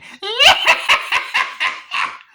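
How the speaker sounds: high, loud shrieking cackle; evil cackle. Retold or imitated. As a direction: let out a wild evil laugh. Chucky laughs as victim dies.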